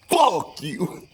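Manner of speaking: high, punched comeback; acting out an angry outburst; retold angry retort. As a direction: fire back in mock outrage. Acted retort at Waffle House.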